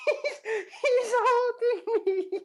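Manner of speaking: put-on high, slow wailing kid voice with laughter breaking in; crying kid scream. Kid pinned by the father wails to everyone. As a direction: wail it like a little kid getting beaten, laughing through it. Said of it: "The kid is screaming".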